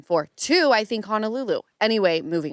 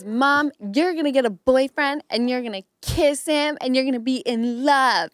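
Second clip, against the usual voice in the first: imitating her daughter; 6 semitones higher than usual. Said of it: "she goes".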